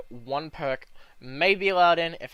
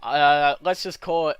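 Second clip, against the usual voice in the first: ordinary talk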